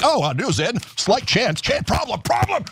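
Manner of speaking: lampoon a news anchor panicking; climbing mock alarm, then casual. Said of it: "anchors who are like".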